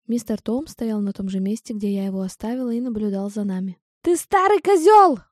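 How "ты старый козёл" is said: put-on furious old woman shouting, higher; angry yell. A narrator's reading. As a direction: hurl the insult at the top of her voice. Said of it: "крикнула мисс Мёрфи". (яростный крик старушки)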